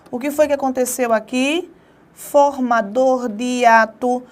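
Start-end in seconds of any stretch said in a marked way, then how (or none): 2.1-4.2 "Formador de hiato" drawn out slowly, each word weighted; slow it right down to make the point; slow emphatic answer. Shown as a demonstration. Teacher stressing the key rule to students, slowly drawn out. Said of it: "Olha o i sozinho".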